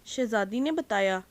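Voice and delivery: ordinary delivery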